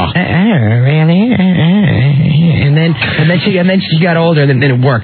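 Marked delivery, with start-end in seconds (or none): 0.0-2.7 imitating Judy Davis. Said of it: "her voice was too low right away".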